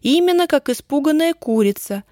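high, emphatic confirmation; со знанием дела. A narrator's reading. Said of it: "сознанием дела сказала Даша". (со знанием дела, уверенно)

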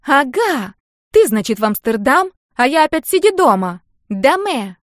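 high, loud squeal of protest; shrill-pitched girl's outburst. A narrator's reading. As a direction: squeal it out, indignant. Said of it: "она взвизгнула".

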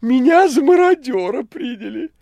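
very high, loud cat-like squeal; Behemoth's voice. A narrator's reading. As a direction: squeal with mock outrage. (высокий голос кота)

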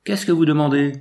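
landlady challenging a stranger in the dark, shouting; called out. Acted. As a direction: call out sharply to an unseen intruder. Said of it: "une voix lui cria".

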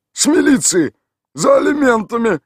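high, loud mock announcement; joking shout. A narrator's reading. Tolik winks and loudly teases Mikhail Ivanovich. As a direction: tease him with a fake police call. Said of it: "подмигнув, добавил".

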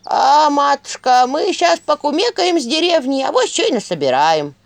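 wink through the words like a crafty elder; sly village elder. A narrator's reading. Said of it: "подмигнул староста".

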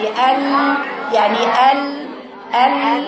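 "ال" with the ل sustained; flowing lam. Shown as a demonstration. Teacher showing what flow of sound means, lam held. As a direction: let the sound run on through the ل. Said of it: "ال يعني جريان الصوت".

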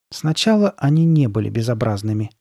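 usual speaking voice

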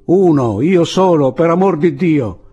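high, loud answer; exasperated shout. A narrator's reading. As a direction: shout it back to the sentry. Exasperated shouted answer to repeated question.

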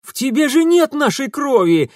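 loud disbelieving outburst; shouting. A narrator's reading. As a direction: hurl the accusation. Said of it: "вскричал Урусов".

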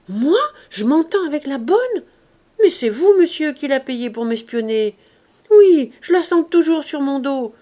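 a wife pushed over the edge, shouting him down; furious outburst. A narrator's reading. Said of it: "cria la jeune femme poussée à bout".

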